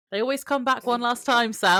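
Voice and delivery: loud, high warning to Sam. Acted. Reading warning note to Sam.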